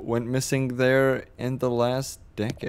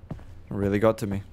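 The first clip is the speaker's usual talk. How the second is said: usual speaking voice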